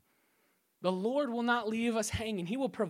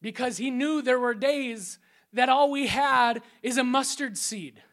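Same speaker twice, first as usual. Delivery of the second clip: usual speaking voice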